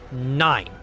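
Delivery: usual speaking voice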